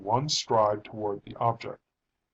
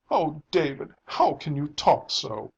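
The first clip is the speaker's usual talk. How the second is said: tearful reproach, higher and louder; a touch of a sob, pitched up; sobbed; 5 semitones higher, 5 dB louder than usual. A narrator's reading. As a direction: plead with him in mild distress. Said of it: "sobbed her mother".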